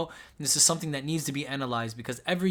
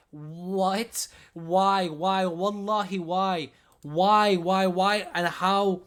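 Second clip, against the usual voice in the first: emotional outburst; 3 semitones higher, 4 dB louder, much slower than usual. Real, not acted. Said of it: "I'm getting emotional".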